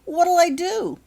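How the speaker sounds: high, loud tearful cry; sobbing. A narrator's reading. Becky sobs to her friends, high and loud. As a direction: cry it out helplessly. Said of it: "sobbed Becky".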